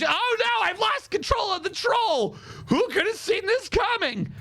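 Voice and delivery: high-pitched hammed-up alarm; mock panic. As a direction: wail in fake dismay, pitched well up. Imitating troll handler losing control.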